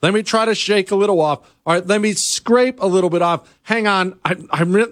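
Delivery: normal talking voice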